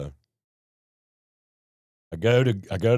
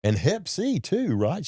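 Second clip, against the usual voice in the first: high mock shock; 10 semitones higher, slower than usual.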